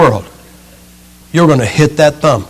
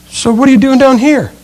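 much higher, curious acted question; high-pitched concern; 8 semitones higher than usual. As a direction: ask with a high lilt.

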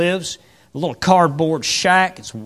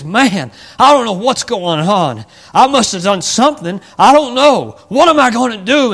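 higher, louder frightened rambling; scared to death; 6 semitones higher, 8 dB louder than usual. Acted. Mephibosheth frightened at the palace, loud scared voice. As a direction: panic at the palace. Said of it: "he's scared to death".

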